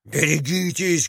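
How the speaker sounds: ordinary delivery, nothing marked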